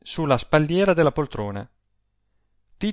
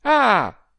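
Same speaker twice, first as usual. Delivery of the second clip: high, loud exclaimed "ah"; sudden cry of discovery; sharp cry; 10 semitones higher, 8 dB louder than usual. A narrator's reading. Adele learns Rossani holds the letters, crying out. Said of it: "gridò Adele".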